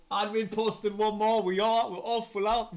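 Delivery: loud, high rush of excitement; thrilled burst of announcement; excited. Real, not acted. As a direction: announce it like the news just broke. New signing posted.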